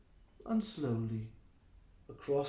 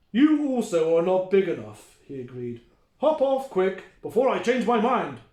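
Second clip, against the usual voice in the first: louder gruff troll; grumpy troll; 8 dB louder than usual. Acted. The troll sends Mike away, louder. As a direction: shoo him off, grumpy.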